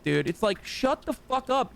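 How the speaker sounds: ordinary delivery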